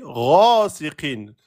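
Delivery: slow "ghasiqin", long first vowel, falling end; long-short-short rhythm. Shown as a demonstration. Teacher showing the scheme, "ghasiqin" long then short. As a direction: hold "gha", then two short beats in "ghasiqin". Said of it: "Deux temps, un temps, un temps".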